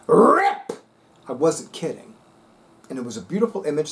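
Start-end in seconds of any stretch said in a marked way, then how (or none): 0.1-0.7 high, loud sudden "Rip!". Shown as a demonstration. Showing viewers she really tore it, loud exclamation. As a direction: shout "Rip!" to prove she isn't kidding. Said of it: "you think I'm kidding?".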